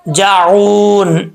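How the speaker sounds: जाऊन held long and slow; slow recitation. Shown as a demonstration. Teacher reciting the word slowly for students. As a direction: stretch जाऊन out. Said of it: "recitation demo".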